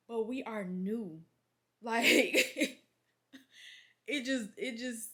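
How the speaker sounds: words breaking into a laugh. Real, not acted. Laughing about being new.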